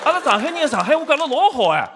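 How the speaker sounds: high, rapid Shanghainese boast; Shanghainese dialect. Shown as a demonstration. Comic proves he is Shanghainese, boastful Shanghai dialect.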